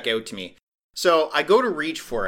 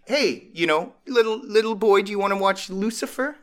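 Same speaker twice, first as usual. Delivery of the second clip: put-on coaxing to a child; 8 semitones higher than usual. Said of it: "he's like".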